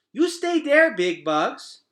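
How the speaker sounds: put-on very high, loud little-girl command; a small child ordering the bugs to stay; bossy toddler order. Boots ordering the roaches to stay, high loud toddler command. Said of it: "said Boots, pointing at Temp".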